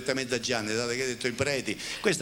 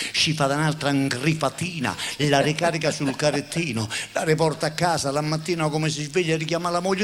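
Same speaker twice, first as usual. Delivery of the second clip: ordinary delivery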